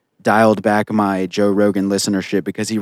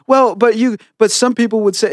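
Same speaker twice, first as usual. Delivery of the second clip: high-pitched mocking imitation of someone hedging; passive-aggressive; 11 semitones higher than usual. Host mocking how a co-host dodged arguments, passive-aggressive. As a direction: mimic a mealy-mouthed objector, pitched well up. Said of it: "passive aggressive".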